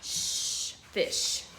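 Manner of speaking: hushed shh then "fish" with a long sh; sh sound drawn out. Shown as a demonstration. Teacher sounding out sh for the class. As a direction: stretch the sh in "fish". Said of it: "let's take a look at our board".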